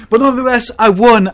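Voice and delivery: high, loud victory cry; excited. Real, not acted. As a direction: shout the win out loud. Celebrating the win.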